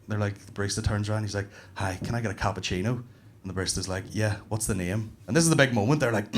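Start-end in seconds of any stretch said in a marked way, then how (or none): 1.8-3.0 soft, low, polite order; understated, hushed customer voice; gentle advert customer. Acted. 4.1-5.2 soft, slow, kindly question; low-key, hushed customer service; gentle advert barista. Acted.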